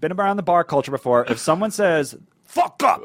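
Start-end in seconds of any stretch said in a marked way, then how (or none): 2.4-3.0 higher, punched Fuck with a falling up; threatening stress. Retold or imitated. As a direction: snap Fuck up like a bar fight starter. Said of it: "like that".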